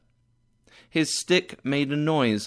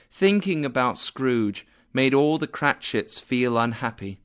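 usual speaking voice

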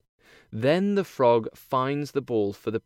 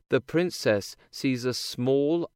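plain reading voice

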